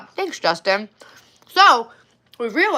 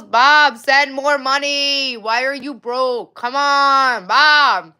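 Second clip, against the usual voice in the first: loud, high whiny plea for cash; slow, drawn-out begging for money; mock begging; 4 semitones higher, 6 dB louder, much slower than usual. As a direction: beg him like a needy girlfriend, hammed up.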